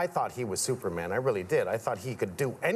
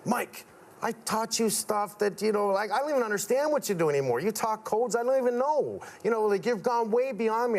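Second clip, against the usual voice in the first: a lightly voiced father, higher; quoting his father; 8 semitones higher than usual. Son voicing his father admitting he's outgrown him. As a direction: let the old man admit he's been surpassed. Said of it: "that's when he goes".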